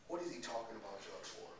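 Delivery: low, hushed private thought; inner thought. Acted. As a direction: think it quietly to yourself, puzzled. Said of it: "I'm in my head like".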